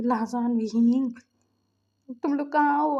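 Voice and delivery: calling out loudly. Acted. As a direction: summon them to hear a complaint. Calling everyone over to complain.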